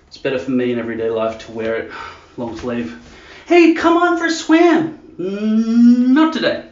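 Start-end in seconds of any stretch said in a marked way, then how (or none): 3.5-5.0 very high, loud, slow put-on voice; hammed-up shrieky pal inviting; friend calling out. Acts out someone inviting him swimming. 5.2-6.7 high, loud put-on refusal; long rising hum into a high reply; mock refusal. Acts out refusing the invitation.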